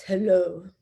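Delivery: drawn-out imitation of a deep-voiced man; deep French-accented voice. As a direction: mimic his deep answering-machine greeting. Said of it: "this deep voice".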